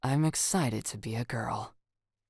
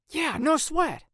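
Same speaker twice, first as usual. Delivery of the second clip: much higher, breezy confidence; confident; 5 dB louder, slower than usual. Acted. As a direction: toss it off confidently.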